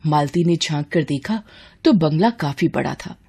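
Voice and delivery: usual speaking voice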